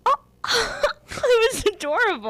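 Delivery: high gasping coo of delight; gushing. Retold or imitated. Woman reacts to two guys making up, high gushing. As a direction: melt over it with mock swooning.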